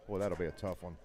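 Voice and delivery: sarcastic. Real, not acted. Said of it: "I know you're being sarcastic".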